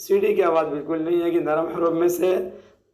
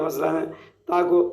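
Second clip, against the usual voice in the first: usual speaking voice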